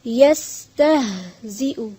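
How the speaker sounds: यस्तहजिउ slowly, with a breathy unbounced h; careful pronunciation. Shown as a demonstration. Teacher says keep one thing in mind, यस्तहजिउ in careful syllables. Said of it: "एक चीज का ख्याल रखें".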